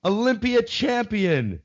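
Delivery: high, slow, grand announcer voice; hammed-up showbiz intro; mock grand announcer. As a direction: announce it like a big title, drawn out. Mock grand show opening.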